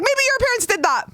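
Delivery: very high, fast, indignant; indignant kid. Retold or imitated. Child hurling it back at classmates, high and indignant. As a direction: throw it back at them. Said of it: "I was so mad".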